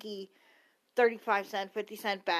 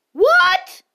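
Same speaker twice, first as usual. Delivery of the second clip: very high, loud mock-surprised yelp; hammed-up astonishment, pitched way up; mock shock; 17 dB louder than usual. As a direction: blurt it in exaggerated disbelief. Mock shock at own claim.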